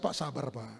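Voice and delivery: low, very soft retold plea; calming someone down. Preacher retelling how he calmed a raging father, soothing. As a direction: soothe him in a hushed low voice.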